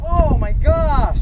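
大声而缓慢地惊叹; amazed exclaim. Real, not acted. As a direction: drag it out, loud and amazed at the fight. Big fish pulling hard.

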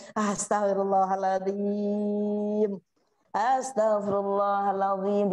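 slow, hushed repentance murmured twice; slow calm istighfar. Acted. A preacher showing mothers how to calm down, slow calm istighfar. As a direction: breathe it out, settling the heart. Voicing someone calming down, istighfar.

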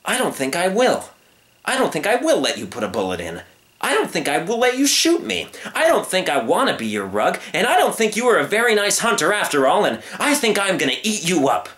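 high, emphatic building refusals; storybook lion turning the tables; defiant young lion. Acted. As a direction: grow bolder with each refusal. Young lion turns on hunter.

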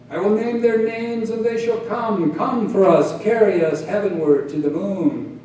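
loud, ringing declamation; grand summons. A narrator's reading. Old poet summoning poets to carry them moonward, declaiming. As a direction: proclaim it like a solemn vow, well projected.

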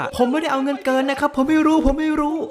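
a mock panicked yelp of innocence; panicked denial. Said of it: "มันก็โวยวาย".

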